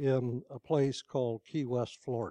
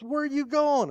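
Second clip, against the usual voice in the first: angry shout; 11 semitones higher, 4 dB louder than usual. Acted. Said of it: "and he says".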